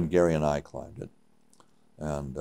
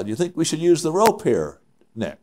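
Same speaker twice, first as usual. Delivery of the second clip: high-pitched mock Swiss accent; higher Swiss-accented voice; 9 semitones higher than usual. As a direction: imitate a Swiss climber asking, pitched up. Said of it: "he has his Swiss accent".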